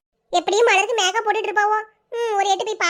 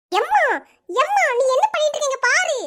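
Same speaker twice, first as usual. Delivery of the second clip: calling out to mom; 4 semitones higher than usual. Acted. Daughter calling out to mother.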